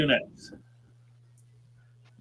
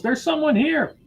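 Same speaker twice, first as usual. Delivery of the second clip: high-pitched, mildly raised mock yell; acted cry of discovery, pitched well up; yelled alarm; 12 semitones higher, 3 dB louder than usual. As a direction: call out the alarm in a raised, higher voice. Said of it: "the figure yells".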